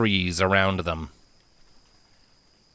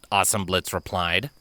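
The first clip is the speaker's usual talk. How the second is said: ordinary delivery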